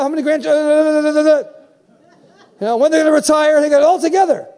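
high, relentless list building up; mock over-planning rattle. Preacher mocks people with life all figured out, high rattled list. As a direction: parody a compulsive planner, piling up items.

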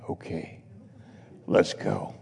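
very soft, near-voiceless inner voice; thinking to himself. Retold or imitated. Preacher answering the Spirit in his head, hushed inner resolve. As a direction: say it under your breath, as a private thought. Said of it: "I'm like thinking".

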